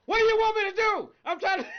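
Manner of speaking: retelling the film's scene of yelling at God; imitating a yell at God. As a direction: cry out at the sky, hammed-up. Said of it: "literally yelling at God".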